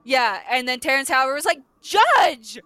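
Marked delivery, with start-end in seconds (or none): 1.8-2.6 loud, high cry to the bench; hammed-up courtroom shout; mock outraged call. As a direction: call out like an objecting lawyer. Acting lawyer calling out in court.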